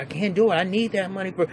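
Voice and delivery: lightly voiced pleading man; mock pleading. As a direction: beg off the job. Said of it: "She said".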